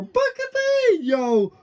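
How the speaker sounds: high, loud excited shout. Real, not acted. Fan spots Booker T entering, high excited shout. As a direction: yell out his name in delight. Surprise entrant appears.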